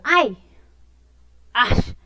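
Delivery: ouch, then an annoyed ash; pained cry. Shown as a demonstration. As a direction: cry out as if you hit yourself. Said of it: "cuando de pronto me golpeo".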